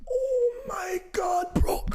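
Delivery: very high, drawn-out wail; hammed-up, slow squeal of dismay; mock dismay. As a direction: stretch it into a high-pitched cry of disbelief. Acts out his horrified reaction.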